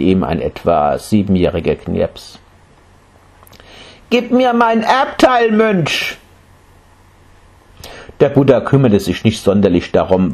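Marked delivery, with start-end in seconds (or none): 4.1-6.2 put-on high child's voice, insistent; a small boy demanding his due. Boy demanding his inheritance from the monk.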